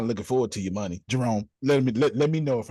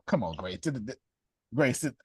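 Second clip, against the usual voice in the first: usual speaking voice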